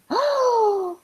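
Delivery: sharp, high gasp of delighted surprise; shocked gasp. Real, not acted. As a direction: suck in breath at big news. Reacts to receiving the text.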